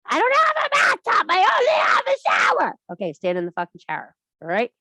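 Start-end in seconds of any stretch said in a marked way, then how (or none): 0.1-2.8 much higher, louder whiny complaining voice; hammed-up shrill-free whine, much higher and louder; mock whiny participant. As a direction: squeal the excuse like a protesting student. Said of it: "I know this is coming".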